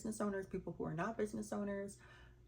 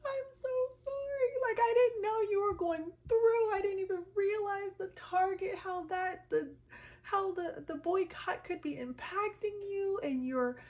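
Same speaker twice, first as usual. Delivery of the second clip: much higher tearful crying voice; crying cousin; 9 semitones higher, 3 dB louder than usual. Retold or imitated. Cousin in tears on FaceTime, crying high. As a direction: sob it out, voice breaking high. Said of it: "this is her".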